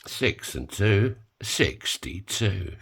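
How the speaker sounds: slow drawn-out bingo call; bingo caller. Acted. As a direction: call the numbers like bingo night. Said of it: "done a bit of, uh, bingo calling".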